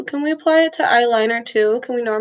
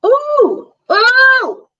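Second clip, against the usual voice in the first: very high, loud delighted squeal; surprised; 14 semitones higher, 8 dB louder than usual. Real, not acted. Reacting to own eyeliner result.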